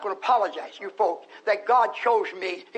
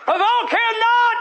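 fervent preaching; 7 semitones higher, much slower than usual. Acted. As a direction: stretch each word out like a preacher's wail. Preaching scripture at full force.

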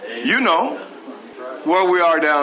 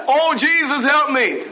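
higher, pleading cry of a jailed man; mock prisoner plea; 7 semitones higher than usual. Preacher mocking prisoners who beg Jesus in jail, higher pleading. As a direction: mimic a prisoner begging Jesus.

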